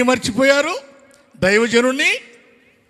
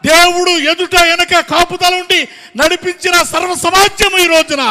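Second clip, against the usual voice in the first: high, loud, driving sermon phrases; full-force preaching; 7 semitones higher, 7 dB louder than usual. Real, not acted. Preacher recounts God's provision to the congregation, full-force preaching. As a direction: build the list in ringing bursts.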